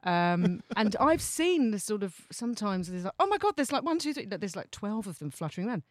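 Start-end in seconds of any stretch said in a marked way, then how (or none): 3.2-4.3 pitched-up excited counting; retold excitement, voice jumping high; excited gasp. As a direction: gasp like you've just spotted them. Retold excited spotting of magpies.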